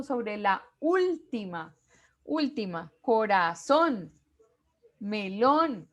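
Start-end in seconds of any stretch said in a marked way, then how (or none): none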